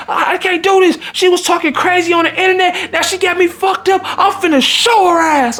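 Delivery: high, heated imitation; hammed-up threat to fight; mock furious woman. Date telling him she's about to fight, imitated high and heated.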